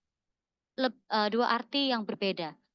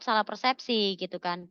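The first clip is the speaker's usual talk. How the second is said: ordinary talk, nothing marked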